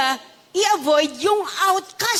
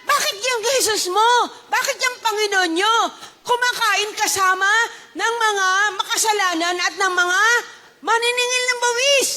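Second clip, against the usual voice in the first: high-pitched, indignant acted questioning; accusing Pharisees; 7 semitones higher than usual. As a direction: demand an explanation as a scandalized Pharisee. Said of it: "Kaya nga anong sabi?". (mapanumbat na tanong ng Pariseo)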